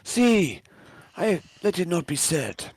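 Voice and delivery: lightly put-on Japanese accent for the lieutenant; a hint of Japanese accent, measured and stern. GM voices the lieutenant scolding the sergeant in a Japanese accent. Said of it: "sorry for the Japanese accent".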